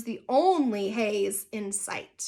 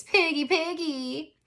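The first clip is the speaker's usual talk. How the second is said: slow, higher sing-song taunt; slow mocking singsong; 4 semitones higher, slower than usual. A narrator's reading. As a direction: tease with a drawn-out jeer. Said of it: "Victoria made a face".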